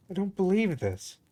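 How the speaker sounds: high-pitched exasperation through gritted teeth; contained, incredulous frustration. A narrator's reading. Yuri reacting to the teacher's mistake, gritted-teeth frustration. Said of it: "Yuri gritted her teeth".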